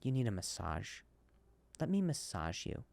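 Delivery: soft, low coaxing voice; husky whisper-like offer of a massage; seductive hush. Retold or imitated. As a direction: murmur it low like a wife trying to lull him. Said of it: "That's what she does".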